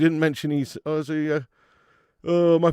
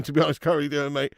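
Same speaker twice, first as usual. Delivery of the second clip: ordinary delivery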